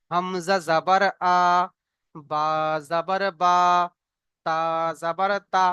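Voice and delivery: slow, chanted syllables; teacher leading a recitation drill; reciting the drill. Shown as a demonstration. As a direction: model the reading slowly for the class. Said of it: "জাবারের পড়া আমরা কি পড়েছি?".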